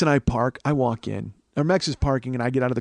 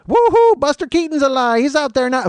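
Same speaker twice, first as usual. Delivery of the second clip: squealing high silly fan voice; mocking giddy fan; 13 semitones higher, 9 dB louder than usual. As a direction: squeal like an overexcited old-movie fan. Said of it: "mock fan".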